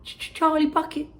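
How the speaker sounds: put-on high, stammering, nervous boy; nervous whisper. Charlie shyly giving his name to Wonka, nervous stammer. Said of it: "a small, nervous voice whispered".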